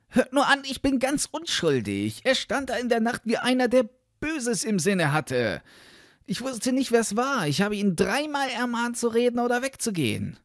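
put-on high-pitched young lad's voice; boy's earnest, high self-defence; guileless boy protesting. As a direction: plead innocence in a high, eager voice.

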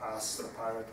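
ordinary delivery

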